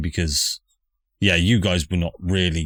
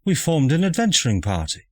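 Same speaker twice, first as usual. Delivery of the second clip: posh mother voice; 9 semitones higher than usual. Acted.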